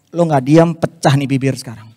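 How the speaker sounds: low, loud menacing threat; angry threat. Retold or imitated. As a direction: threaten him through anger. Mengancam orang itu, marah. (mengancam dengan suara rendah dan keras)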